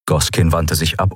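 usual speaking voice

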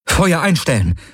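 high-pitched combat order; urgent command. A narrator's reading. Urgent order during battle.

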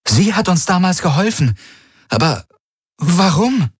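high-pitched, amazed disbelief; amazed, pitch leaping up on the question; astonished. A narrator's reading. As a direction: puzzle it out in astonishment. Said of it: "sagte Kirai und blinzelte erstaunt".

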